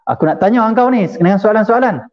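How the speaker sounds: high-pitched, hectoring questions; imitating a harsh interrogator; rough, bullying demand. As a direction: press the question as if intimidating. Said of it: "Kasar. Ger-, ada gertak. Keras".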